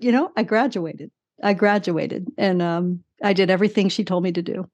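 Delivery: ordinary talk, nothing marked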